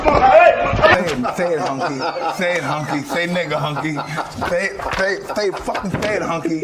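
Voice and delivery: high, loud, mocking taunt; egging the interviewer on with a jeering chant; taunting demand.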